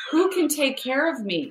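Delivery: high, slow mock plea; self-pitying. Speaker gives an example of the wrong question, self-pitying. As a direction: plead helplessly, drawing it out.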